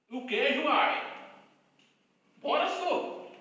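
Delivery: slow, lilting mock Minas Gerais accent. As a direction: put on a stretched-out mineiro accent. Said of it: "É o sotaque de Minas Gerais".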